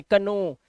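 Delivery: "कनो" with an audible K; two-syllable mistaken "कनो"; wrong reading of know. Shown as a demonstration. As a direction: add a "क" before "नो": "कनो". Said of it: "कनो नहीं पढ़ना है".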